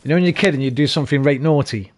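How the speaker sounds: ordinary talk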